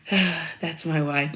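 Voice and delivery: slow, dreamy "That's my wife"; lovestruck Edward. Retold or imitated. Edward gushing about his wife, slow and dreamy. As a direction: sigh it out like a smitten husband. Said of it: "he was just so like".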